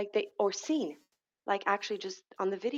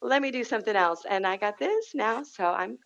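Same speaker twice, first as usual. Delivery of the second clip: loud and laughing at herself; 9 dB louder than usual. Retold or imitated. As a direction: laugh through the self-tease. Laughing at old hairdos.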